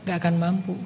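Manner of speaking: ordinary talk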